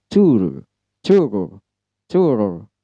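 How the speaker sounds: tour with an R you must hear; American tour, R required. Shown as a demonstration. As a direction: stress the R in tour. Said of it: "R-nya wajib".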